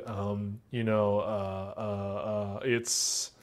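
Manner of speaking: mock hesitation, fading off; hesitant fillers. Shown as a demonstration. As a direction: stall while thinking. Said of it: "they'll just start saying things".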